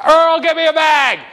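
high, loud shout to a coworker; calling out. Pretend. Cashier Mabel yelling at Earl for a bag, high shout. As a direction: holler across the store for a bag.

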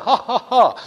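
hammed-up ha ha ha; a playful banker's laugh; put-on jolly laugh; mock hearty laugh.

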